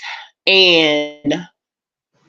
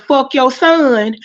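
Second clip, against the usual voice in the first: slow, higher mock yell; retold holler; 6 semitones higher, slower than usual. Repeating the man's hollered insult, slow mock yell. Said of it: "that motherfucker hollered".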